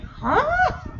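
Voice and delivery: high-pitched mock "huh?"; exaggerated, squeaky-high confusion; baffled reaction. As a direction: act out utter bafflement, pitch shooting up. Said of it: "The look on Nadia's like".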